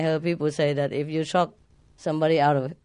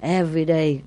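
ordinary delivery

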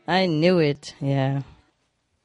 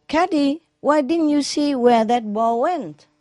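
calling golfer; 8 semitones higher than usual. Acted. Golfer calls to caddy.

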